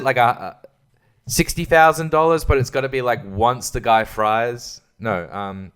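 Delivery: normal talking voice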